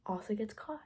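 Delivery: hushed dramatic whisper. Real, not acted. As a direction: whisper it like a secret. Dramatic reveal in story recap.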